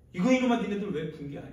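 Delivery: acted furious telling-off; angry rebuke. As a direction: burst out in indignation at them. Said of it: "제자들이 막 화를 내는 거예요".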